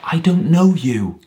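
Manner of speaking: high, loud denial; crying out. A narrator's reading. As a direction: cry it out in desperation. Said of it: "cried Walter".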